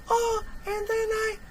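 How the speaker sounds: very high, thin imitation of a child; kid's voice. Imitating the radio kid for viewers, very high. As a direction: squeak it out like a young boy on the radio. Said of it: "But the kid's voice".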